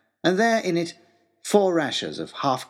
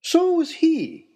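much higher, slow dry quip; the professor; 3 dB louder, much slower than usual. Acted. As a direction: drawl the aside like a fussy professor. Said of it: "interjected the professor".

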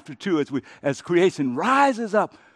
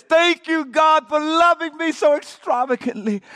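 high, loud triumphant proclamation; exultant preached shout of thanks; shouted praise; 10 semitones higher, 8 dB louder than usual. Shown as a demonstration. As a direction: belt it out to the whole room. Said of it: "get this".

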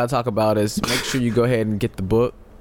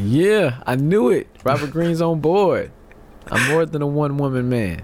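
imitating a player; 6 semitones higher than usual. Cohost mocking players who love this law, hammed-up gloat. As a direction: gloat like a smug ladies' man. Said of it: "all the players out there are like".

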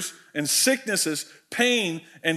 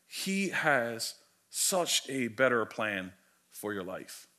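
hushed; 7 semitones lower, 8 dB quieter, slower than usual. Real, not acted. Sermon point dropping to near whisper.

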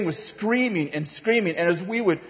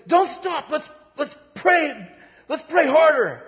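much higher, urgent rallying cry; panicky urging; 11 semitones higher than usual. Retold or imitated. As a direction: urge the frightened group on in a high voice. Urging group to pray over screams.

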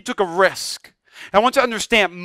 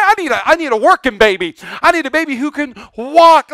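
loud, raised hammed-up complaint; mock angry father; 5 semitones higher, 6 dB louder than usual. Father about his baby who fell, mock indignant rant. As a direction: rant in mock outrage at a toddler. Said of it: "We didn't get mad at him".

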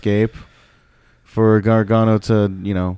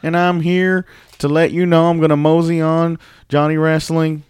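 higher-pitched, hammed-up southern accent; mosey through it in a mock southern accent; 6 semitones higher than usual. Host playing the wrestler's promo, mock southern accent. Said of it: "I don't know why I have a southern accent".

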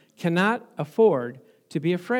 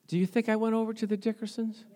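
ordinary talk

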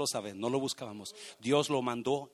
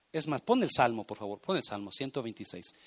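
ordinary delivery

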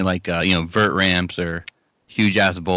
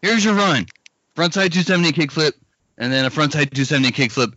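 high, pushy coach voice, fading down; Lutzka coach impression; 5 semitones higher than usual. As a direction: hand out the same trick list. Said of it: "Here's my impression of Lutzka as a coach".

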